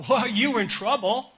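high, loud scolding call; mock scolding God. Preacher voicing a scolding God summoning Adam and Eve. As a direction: scold them like a fed-up parent. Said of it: "not about God saying".

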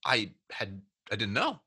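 soft, low, halting admission; near tears. Retold or imitated. Rep admitting to his boss he had no idea, near tears. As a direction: imitate someone subdued and close to crying. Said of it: "I thought he was gonna start crying".

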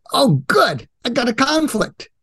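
high, cheerful mock enthusiasm; mock delight. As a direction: gleefully welcome it, pitched high. Acted delight at a conflict.

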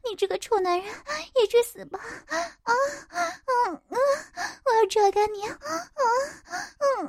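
high, frenzied moaning shouts; wild crying out. Acted. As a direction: cry out in wild, eerie ecstasy. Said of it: "嘴里狂喊着".

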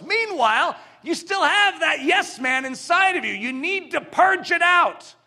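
loud, high, forceful preaching. Acted. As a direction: shout it like a fiery preacher.

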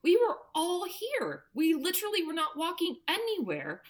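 high, loud alarmed outburst; panicked disbelief. Retold or imitated. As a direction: blurt it out in a sudden panic. Panicked parent finding child gone.

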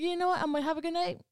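high, sing-song goodbye with an edge; sarcastic brush-off. Retold or imitated. To the rude caller, a high fake-sweet goodbye.

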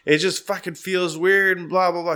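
whiny wife. Retold or imitated. Wife whining to the roommates that it feels weird. Wife complaining about burning skin.

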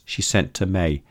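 ordinary delivery, nothing marked

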